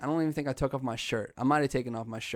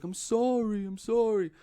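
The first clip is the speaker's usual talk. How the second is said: high, whiny pleading voice; apologetic little brother; 10 semitones higher, 4 dB louder than usual. Retold or imitated. Hank apologizing to his rescuer, high pleading. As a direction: imitate a kid apologizing over and over. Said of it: "And he's like".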